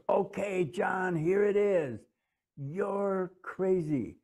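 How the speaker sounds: high, slow and cutting, drawing out the verdict; ex-wife's voice. Retold or imitated. Ex-wife mid-argument at John, high and slow. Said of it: "and she goes".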